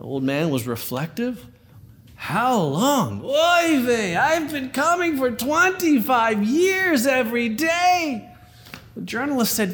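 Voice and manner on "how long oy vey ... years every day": put-on Yiddish accent.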